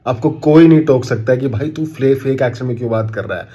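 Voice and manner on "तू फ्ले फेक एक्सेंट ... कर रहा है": ordinary delivery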